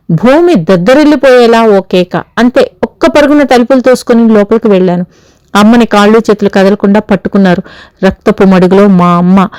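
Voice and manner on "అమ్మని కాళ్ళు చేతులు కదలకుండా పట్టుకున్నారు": plain reading voice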